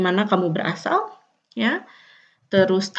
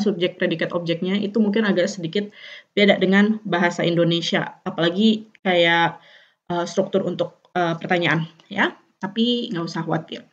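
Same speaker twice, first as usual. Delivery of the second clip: ordinary talk, nothing marked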